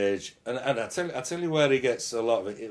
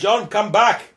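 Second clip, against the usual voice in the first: calling out; 12 semitones higher, 11 dB louder, slower than usual. Acted. Helmut calls the host back.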